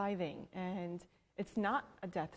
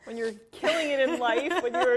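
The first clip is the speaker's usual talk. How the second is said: louder, laughing through the words; host laughing along with her guest; 4 semitones higher, 11 dB louder than usual. Real, not acted. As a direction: giggle it out.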